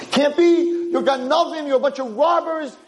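put-on screaming drunk. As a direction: rage at them like you've been robbed. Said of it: "And he starts screaming".

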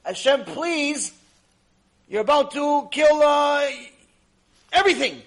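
loud, high, halting entreaty; acted tearful plea. Angels crying to Hashem for Isaac, acted plea. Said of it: "they're crying to Hashem with more tears".

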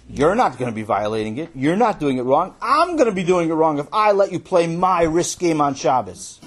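loud, insistent protest; indignant arguing. Acted. Boy arguing why he cannot share, loud and indignant. Boy argues against sharing.

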